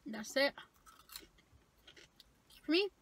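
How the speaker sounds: talking while chewing; speaking between bites; mouth full of fries. Real, not acted. Seen 1: Fry into mouth at 0.2, cheeks full chewing till 3.8.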